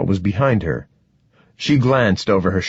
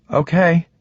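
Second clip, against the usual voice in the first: high, uncertain, lifted at the end; unsteady voice; 8 semitones higher than usual. A narrator's reading. Ruby agreeing to trust Stoney, unsteady. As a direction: agree with a tentative upward lilt. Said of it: "her voice unsteady".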